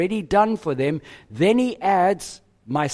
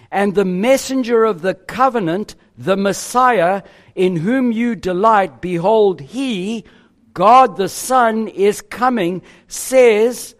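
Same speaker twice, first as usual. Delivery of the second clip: louder reading with each pronoun named firmly; pronouns stressed; 3 semitones higher, 4 dB louder than usual. Shown as a demonstration. Preacher reading Malachi aloud to congregation, naming pronouns with loud stress. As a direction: hammer home who each pronoun is. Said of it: "emphasis on the pronouns".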